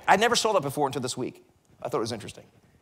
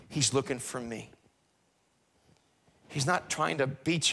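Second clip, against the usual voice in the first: lower, softer, gentle pastoral voice; tender reassurance; 5 semitones lower, 4 dB quieter than usual. Acted. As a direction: comfort the listener who has failed.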